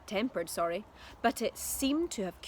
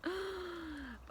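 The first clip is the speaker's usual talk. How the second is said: gasp; 9 semitones higher, 8 dB quieter than usual. A narrator's reading. Reader gasps at story.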